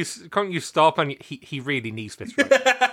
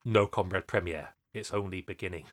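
sinister advisor voice; 9 semitones lower, 9 dB quieter than usual. Retold or imitated. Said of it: "the Russian premier is like".

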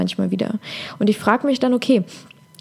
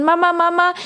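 higher, whining daughter; whiny little girl; 7 semitones higher than usual. Retold or imitated. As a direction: whine at mum like a small girl. Daughter Jacqueline whines to mother.

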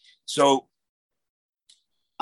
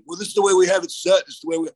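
higher, louder mock huffy protest; mock annoyed wrestlers; 7 semitones higher, 4 dB louder, faster than usual. Referee mocking the wrestlers annoyed at his change, huffy. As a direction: parody their irritated insistence. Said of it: "they're all pissed off".

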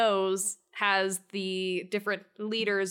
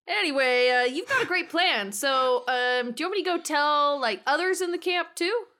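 higher, louder eager offer; 6 semitones higher, 4 dB louder than usual. Real, not acted. [name] offers Mother Jones help, eager and bright. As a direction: jump in keen to help.

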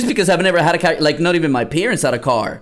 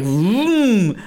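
drawn-out imitated car vroom; engine racing sound; 5 semitones higher than usual. Telling how his first car sounded, imitated racing engine. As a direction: imitate an engine revving up, stretched out. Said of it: "it would make this sound".

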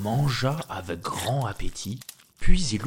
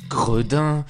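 louder indignant cry; scolding giantess; 3 semitones higher, 6 dB louder than usual. Acted. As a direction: snap at the little thief. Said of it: "s'écria la géante". (géante indignée qui crie)

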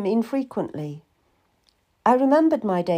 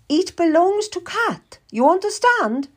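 high, insistent warning from a noblewoman; stern old lady; 11 semitones higher, 4 dB louder than usual. Acted. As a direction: lay down the law in a high voice. Old lady warns the husband.

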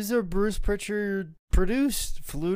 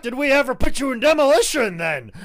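high, loud hammed-up tirade; outraged Vince; 5 semitones higher, 5 dB louder than usual. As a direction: demand it like an exasperated boss. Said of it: "Vince's like".

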